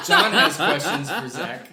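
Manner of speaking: loud and slow, laughing through it; laughing mock announcer voice; laughing announcement. Real, not acted. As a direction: announce it grandly while cracking up.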